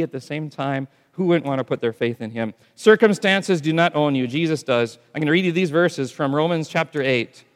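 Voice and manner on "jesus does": usual speaking voice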